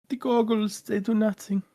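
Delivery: high, put-on cartoon voice; cartoon catchphrase impression. Quoting a cartoon for laughs, high put-on voice.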